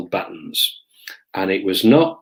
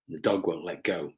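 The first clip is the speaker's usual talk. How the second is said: usual speaking voice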